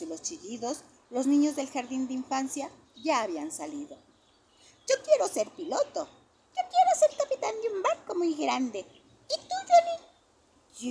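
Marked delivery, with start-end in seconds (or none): 4.9-10.9 put-on high, bright small-child voice; little child voice. A narrator's reading. Kindergarten kids tell each other their dreams, high child voice. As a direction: play a kindergartner boasting.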